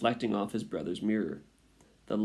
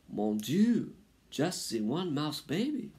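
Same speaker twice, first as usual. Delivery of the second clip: high-pitched French mother mouse; French accent; 7 semitones higher, slower than usual. A narrator's reading. Mother mouse learns of her only baby, French-accented dismay. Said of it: "She was a French mouse".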